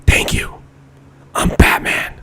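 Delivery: whispered mock superhero sign-off; joking whispered Batman voice; Batman joke voice. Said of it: "He's Ba-, no, I'm kidding".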